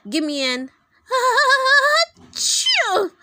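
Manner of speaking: loud cheer bursting into a sneeze; shout, then sneeze it high and loud. A narrator's reading. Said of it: "sneezed the cheerleaders".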